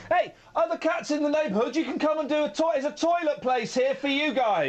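high-pitched call out to the cats; playful, high announcement to the neighbourhood; mock invitation. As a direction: invite them over in a put-on high voice. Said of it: "Basically, you're saying".

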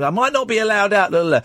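high-pitched, hammed-up babble; loud, over the top. Mimicking himself babbling at the magician, over the top. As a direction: replay an over-excited babble, pitched right up. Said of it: "I was being very loud and over the top".

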